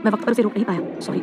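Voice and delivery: soft, subdued and remorseful; hushed, regretful murmur; tender apology. A narrator's reading. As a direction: apologise quietly while holding her. Husband apologises while holding her close.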